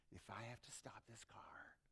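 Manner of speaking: whispered threat; stern parent whisper. Acted. Parent warning the kids in the car, whispered threat.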